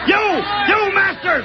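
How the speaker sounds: high, raging yell at the deserters; a captain's enraged cry at his crew; furious shout. Acted. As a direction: bellow it out in disgust.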